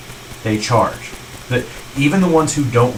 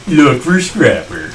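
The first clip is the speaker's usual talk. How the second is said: slow, drawn-out mock plug; odd put-on voice; 3 semitones higher, 4 dB louder, slower than usual. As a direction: stretch the plug out in a silly put-on voice. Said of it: "I don't know what that was about".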